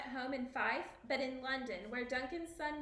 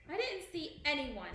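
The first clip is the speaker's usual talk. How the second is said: ordinary delivery, nothing marked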